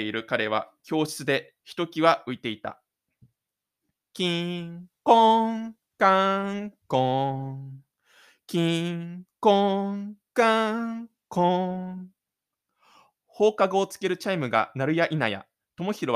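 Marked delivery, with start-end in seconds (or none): 4.1-12.1 slow chime sung on held notes; sung school chime. Acted. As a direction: ring it like the school bell.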